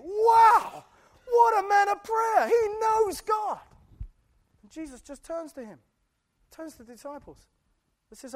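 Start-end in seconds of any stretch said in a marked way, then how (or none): 0.0-3.6 high, loud, slow mock amazement; mock awe. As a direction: gush in admiration, hammed-up. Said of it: "what would you think?".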